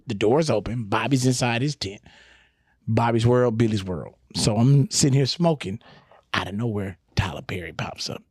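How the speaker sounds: ordinary talk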